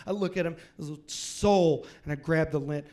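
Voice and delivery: normal talking voice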